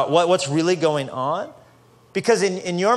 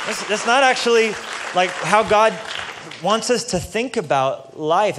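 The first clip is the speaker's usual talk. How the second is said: building sermon; 4 semitones higher than usual. Acted. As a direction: build the fire line by line until it peaks on raw.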